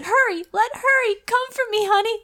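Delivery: high, hammed-up urgent plea; mock urgent. Reading erotic lines aloud.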